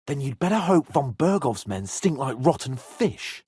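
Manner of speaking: normal talking voice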